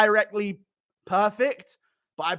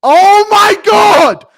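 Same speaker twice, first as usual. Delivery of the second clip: drawn-out, high-pitched full-volume yell; over-the-top shout; 16 dB louder, much slower than usual. Acted. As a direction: bellow it high and slow. Demonstrating an over-the-top corner shout.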